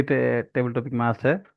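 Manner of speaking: ordinary talk, nothing marked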